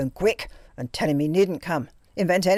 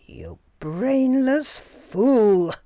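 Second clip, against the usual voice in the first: slow, louder and higher venomous insult; hissed in fury; 7 semitones higher, 7 dB louder, much slower than usual. A narrator's reading. Anita turning on frightened Greta, furious and slow. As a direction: spit the words at her like a beast. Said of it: "she hissed".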